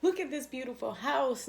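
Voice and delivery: proudly showing her dream house to her friend; excited showing off. Retold or imitated. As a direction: show off the house in a bubbly high voice. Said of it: "And I was like".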